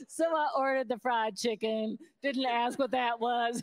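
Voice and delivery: giggling through the punchline; laughing. Real, not acted. Tells friend the punchline while laughing.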